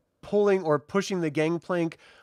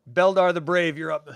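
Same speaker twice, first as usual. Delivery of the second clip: normal talking voice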